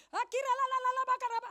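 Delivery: high, hammed-up babbling in tongues; mock a showy tongues speaker; mock speaking in tongues. Mocking believers who babble in tongues, high and hammed-up. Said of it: "Anda lagi nunjukin apa?".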